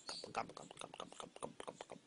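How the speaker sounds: very soft, rapid turkey gobbling; hushed little turkey gobbles. Acted. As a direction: imitate a turkey under your breath. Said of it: "and the turkey says".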